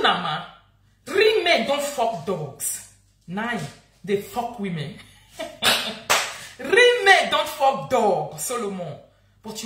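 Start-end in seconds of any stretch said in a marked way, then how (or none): 1.1-2.9 each word of "real men don't fuck dogs" spaced out slowly; slow emphatic declaration. Real, not acted. To Solomon on live video, slow scornful emphasis. 6.6-8.4 "Real men don't fuck dog" dragged out slowly and louder; slow emphatic repeat. Real, not acted. Repeating her jab at Solomon, slow and loud.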